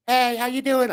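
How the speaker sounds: very high, loud little greeting; high-pitched small-person impression; little man's voice. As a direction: call up in a tiny squeaky voice. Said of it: "The voice goes".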